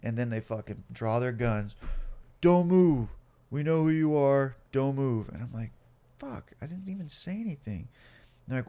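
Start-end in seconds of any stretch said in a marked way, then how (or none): 2.4-5.3 loud, raised-pitch shouted orders; police command. Acted. Cops with guns drawn to suspect, shouted commands. 6.2-7.9 soft, high-pitched mock complaint; hushed protest. Man facing police guns, hushed high protest.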